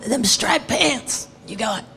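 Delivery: put-on slow, lightly rasped old man; a hint of a raspy elder. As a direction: drag it out like an old jazzman. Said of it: "the raspy voice".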